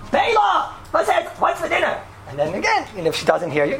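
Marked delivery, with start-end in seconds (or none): 0.1-2.0 high, carrying domestic call. Acted. Husband testing his wife's hearing from the next room, calling out high. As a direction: pitch it high to reach someone in another room. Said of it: "you go to the room".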